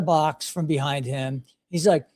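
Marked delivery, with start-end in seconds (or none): none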